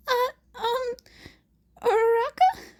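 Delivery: high, shaky hesitant question; nervous stammer. Acted. Izuku nervously addressing a glaring Uraraka. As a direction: timidly test the waters.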